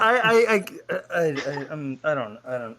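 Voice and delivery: tripping over the first word, restarting; stammering. Real, not acted. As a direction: get stuck on "I" and keep restarting. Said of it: "I'm stumbling over my words".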